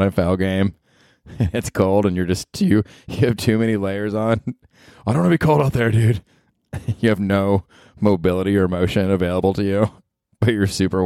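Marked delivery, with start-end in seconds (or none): 5.1-6.2 higher, whiny dumb-jock voice; mock a bundled-up player; lightly voiced bro complaining. Mocking overdressed player.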